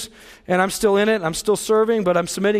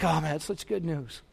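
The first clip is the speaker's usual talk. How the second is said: wife's quiet awe; 7 semitones lower, 12 dB quieter than usual. Retold or imitated. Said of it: "She's like".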